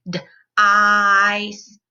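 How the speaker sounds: d-ice drawn out very slowly; slow stretched sounding out. Shown as a demonstration. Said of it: "It has long I in it".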